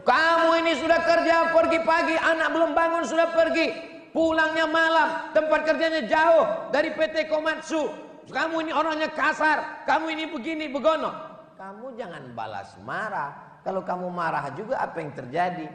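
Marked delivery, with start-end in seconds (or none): 0.0-11.1 nagging wife. Acted. Wife scolding husband about his job, high nagging. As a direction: nag him like a fed-up wife.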